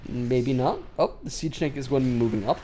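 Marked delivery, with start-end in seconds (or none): none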